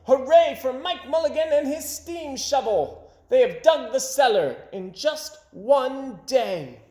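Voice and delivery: high, loud, slow celebrating cheer; crowd cheer. A narrator's reading. Crowd cheering the finished cellar, loud and high. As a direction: shout it in triumph.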